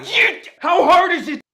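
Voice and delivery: a teacher snapping at a joking student; frustrated yell. Acted. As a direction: lose patience with the kid.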